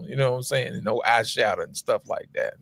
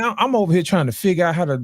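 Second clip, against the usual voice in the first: ordinary talk, nothing marked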